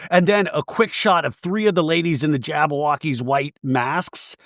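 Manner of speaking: ordinary talk, nothing marked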